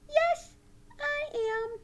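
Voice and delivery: high, loud puppet answer; high-pitched keen puppet voice; eager puppet. Pretend. Puppet Sally answers teacher.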